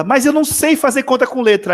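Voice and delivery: high-pitched retold cry of frustration; imitated outburst. Silent pupil suddenly speaks up to her teacher, imitated high outcry. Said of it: "que ela gritou assim".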